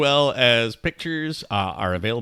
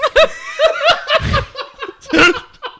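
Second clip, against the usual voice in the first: loud high laugh; 9 dB louder than usual. Real, not acted. Big laugh after teasing.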